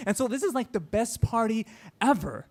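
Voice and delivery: normal talking voice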